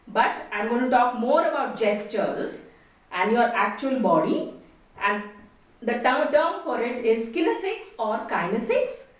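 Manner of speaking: ordinary talk, nothing marked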